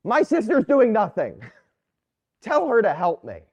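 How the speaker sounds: half-hammed whining sister; complaining Martha. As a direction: Martha voiced lightly, tattling on her sister. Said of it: "Martha looks at Jesus and says".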